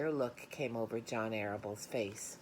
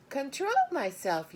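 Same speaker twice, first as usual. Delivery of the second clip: high, loud yell; girl yells at her father; 14 semitones higher, 7 dB louder than usual. A narrator's reading. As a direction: throw his words back at him. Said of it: "yelled Fern".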